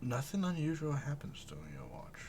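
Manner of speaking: near-whispered game master narration; hushed narration. A narrator's reading. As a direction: drop to a whisper for the night watch. Night watch while others sleep.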